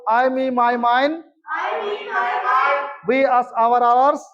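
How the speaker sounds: chanted pronoun sets with long gaps; read-along drill. Shown as a demonstration. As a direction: lead the class, leaving room to echo. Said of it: "एक बार मेरे साथ पढ़ लो".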